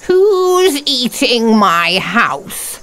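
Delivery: slow, creaky witch question; drawn-out old crone voice. Acted. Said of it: "asked the old woman".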